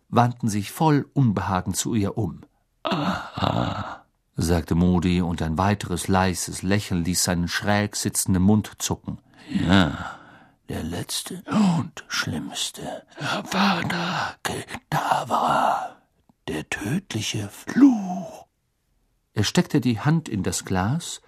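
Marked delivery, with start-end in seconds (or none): 2.8-4.0 soft, breathed acknowledgement; quiet knowing reaction. A narrator's reading. Teacher hearing the awaited answer, quiet knowing murmur. Moody reacts, slight smile. 9.5-18.5 soft, very slow, breathy menace; ominous Auror teacher. A narrator's reading. Teacher naming the worst curse to pupils, ominous slow hush. Moody names the deadly curse.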